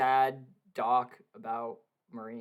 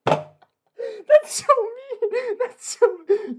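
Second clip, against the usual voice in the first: laughing; 9 dB louder, much slower than usual. Real, not acted. Laughing at friend's pain.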